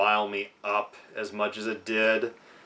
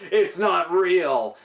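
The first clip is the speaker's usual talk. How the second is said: high, slow mock scream; imitated screaming; 7 semitones higher, 4 dB louder, slower than usual. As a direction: imitate a man screaming in denial, pitch raised.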